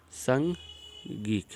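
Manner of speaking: "संगिक" drawn out very slowly, syllable by syllable; syllable demo. Shown as a demonstration. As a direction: spell "संगिक" out slowly for the students. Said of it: "आनु आया". ("संगिक" बहुत धीरे अक्षर-अक्षर बोलना)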